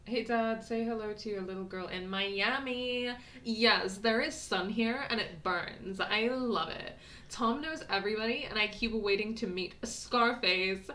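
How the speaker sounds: bubbly postcard voice. Acted. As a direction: read it like an excited girl writing home. Reads postcard in girl's voice.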